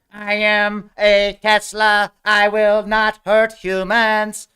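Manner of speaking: slow, high, loud robot drone. Pretend. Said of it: "It's like going all".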